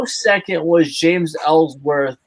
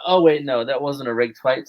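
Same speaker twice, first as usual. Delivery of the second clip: ordinary delivery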